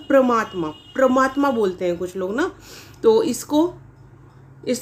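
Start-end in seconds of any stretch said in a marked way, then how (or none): 0.1-1.5 परमात्मा said as "pramatma", first vowel dropped; common mispronunciation. Shown as a demonstration. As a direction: run the first syllable of परमात्मा together as "pra". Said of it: "इसको जब हम बोलते हैं तो कैसे".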